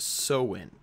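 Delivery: Samhain said as SOW-in; actual pronunciation. Shown as a demonstration. Host correcting the spelled reading for viewers, Samhain as SOW-in. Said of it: "it's actually pronounced".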